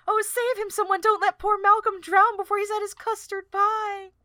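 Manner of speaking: high, loud melodramatic plea; Celandine. Acted. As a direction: cry out in hammed-up theatrical alarm. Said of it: "And Celandine".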